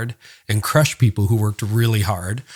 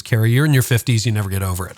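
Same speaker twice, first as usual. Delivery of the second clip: normal talking voice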